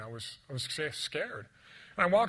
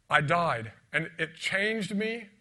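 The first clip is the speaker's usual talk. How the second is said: loud, higher, impassioned preaching; preaching at full force; 5 semitones higher, 8 dB louder than usual. Acted. Preacher telling the congregation he was dead, loud and fervent. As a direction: build to a fervent climax.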